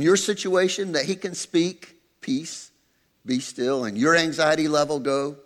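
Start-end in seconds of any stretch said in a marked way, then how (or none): 2.2-3.8 soft calm. Acted. God speaking peace to anxiety.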